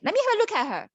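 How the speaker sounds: a curious hero demanding to see her; eager prince. Acted. Prince insisting to the crowd, high and eager. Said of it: "he's like".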